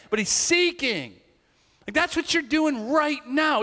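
high, loud, building exhortation; rally them to keep seeking; preaching at full force. Real, not acted. Pastor urging congregation, impassioned preaching.

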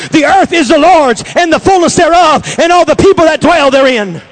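loud, high-pitched shouted declaration, building; full-force preaching. Real, not acted. Preacher declaring the county taken for God, fiery shouted preaching. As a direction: proclaim it like a battle command, at the top of the voice.

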